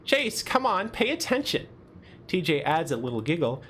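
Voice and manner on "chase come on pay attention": high-pitched teasing scolding; light chastisement. Acted. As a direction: chide a friend in a higher voice.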